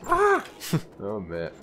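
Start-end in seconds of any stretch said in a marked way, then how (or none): 0.1-0.4 loud, high mock dwarf war cry; Gimli's battle yell. Gamer copying the dwarf sound for viewers, loud high yell. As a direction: belt out the dwarf's yell as he leaps. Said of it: "Swings the axe".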